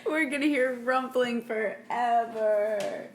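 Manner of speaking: real laughter through the words; laughing. Real, not acted. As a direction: complain about the noise while cracking up. Cracking up over cat in bag.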